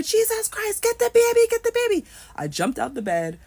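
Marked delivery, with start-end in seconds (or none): 0.0-2.0 high, loud Trinidadian yell; shouted Trini accent. Retold or imitated. Unseen voice urges mother to save baby, Trini accent. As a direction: bellow it like a panicked Trini uncle. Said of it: "So this is a Trini accent".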